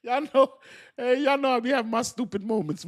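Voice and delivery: high, giggly admission; sheepish laughing voice; laughing confession. Real, not acted.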